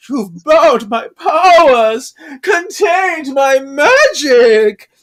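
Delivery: high, loud, slow tearful wail; sad lament. Retold or imitated. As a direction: mourn the lost powers out loud. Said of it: "She's, like, so sad".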